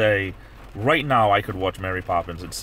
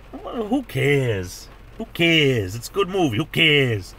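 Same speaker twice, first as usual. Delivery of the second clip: 1 Cockney imitation; 5 semitones higher, much slower than usual.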